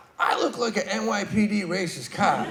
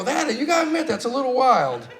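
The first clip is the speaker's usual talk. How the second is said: teasing jab; 9 semitones higher than usual. Retold or imitated. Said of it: "I go".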